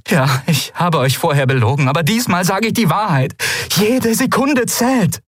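urgent plea climbing high, slowing at the end; pleading. A narrator's reading. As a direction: beg to be believed. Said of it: "flehte sie". (eindringlich flehend, immer höher)

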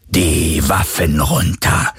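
very slow electronic command; booming Druhun voice. A narrator's reading. Druhun order Galen to disarm, booming machine voice. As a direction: drone it like a menacing war machine. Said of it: "dröhnte die Druhun-Stimme erneut".

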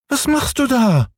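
hohe, quengelnde Kinderstimme; kleiner Junge schreit. Acted. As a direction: fordernd wie ein ungeduldiges Kind. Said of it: "rief der kleine Häwelmann hinauf". (hoch und fordernd gerufen)

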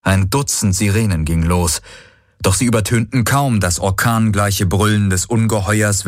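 usual speaking voice

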